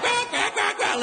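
very high imitated retort; duck quack. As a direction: quack back like an affronted drake. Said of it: "the other one's like".

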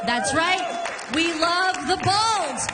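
rally the crowd; hype shout. Real, not acted. Hyping crowd.

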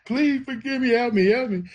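put-on a child sobbing out a plea for help; retold crying plea. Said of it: "I'm crying and I'm telling him".

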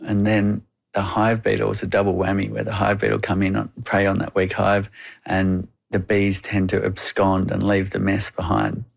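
normal talking voice

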